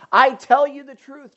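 loud, ringing declaration; emphatic quote. Shown as a demonstration. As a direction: proclaim it to the whole room. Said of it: "quoting Jesus emphatically".

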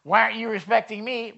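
a touch of parental indignation; mouthy demand. Retold or imitated. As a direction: snap at the kids, half-acted. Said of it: "for me to get mouthy".

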